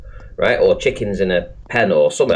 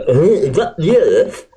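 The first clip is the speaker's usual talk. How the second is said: slow, higher, dopey gawping voice; brain dead zombie; 5 semitones higher, slower than usual. Retold or imitated. Mocking stream viewers as slow, dopey zombies. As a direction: play a slack-jawed viewer slowly. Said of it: "brain dead zombies go".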